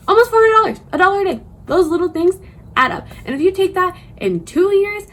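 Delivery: high, loud, thrilled emphasis; excited. Real, not acted. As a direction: hype up the savings. Stressing savings, big excited jump.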